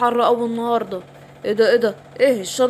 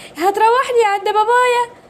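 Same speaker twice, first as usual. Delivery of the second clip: little princess; 9 semitones higher than usual. Acted. Princess asks the king.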